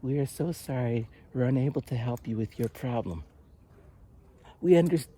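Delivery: imitating a syrupy polite phone agent; polite service agent voice. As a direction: mimic a bland customer service rep. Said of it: "like, that kind of voice".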